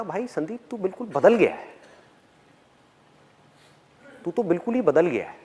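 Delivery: mildly stunned, not pleased; unhappy, shocked tone. Retold or imitated. School friend tells Sandeep he has changed, in an unhappy, shocked tone. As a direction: imitate a friend's subdued disbelief. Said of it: "उसकी जो tone थी ना बोलने की, वो खुशी वाली tone नहीं थी".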